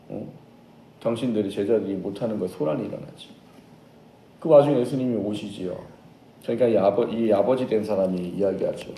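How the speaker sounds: normal talking voice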